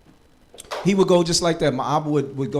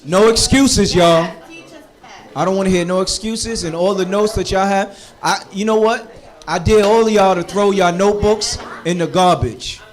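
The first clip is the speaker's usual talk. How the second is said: much louder, higher preaching dare; fiery challenge; 3 semitones higher, 9 dB louder than usual. Real, not acted. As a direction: challenge the students with full force. Teacher challenging students loudly.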